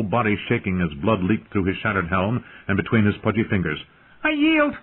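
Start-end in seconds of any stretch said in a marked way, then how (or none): 4.2-4.8 very high, shrill terrified squeal. Acted. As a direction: shriek it out in panic. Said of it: "he shrilled".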